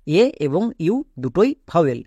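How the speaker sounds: ordinary delivery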